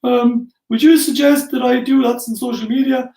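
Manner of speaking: high, hesitant mock questioner; timid audience question. Mimicking an audience member asking the mentor a timid question. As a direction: pipe up like an unsure attendee asking a basic question. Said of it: "everyone's like".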